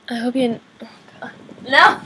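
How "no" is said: loud, high cry of no breaking into laughter; laughing no. Real, not acted. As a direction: shout no then burst out laughing.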